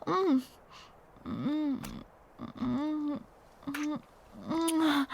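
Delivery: quiet kissing hums; soft kissing murmurs. Acted. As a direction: murmur softly while kissing. Kissing sounds again.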